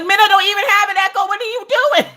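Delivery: fast, high-pitched protest; comic squawk of frustration; exasperated outburst. Acted. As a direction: rattle it out high, in disbelief. Exasperated at Echo's absurd call.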